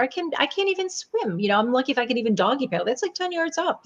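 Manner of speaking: high-pitched mock panicky excuses; hurried, high retelling of a reluctant objection; retold protest. Acted protest to Jesus about swimming.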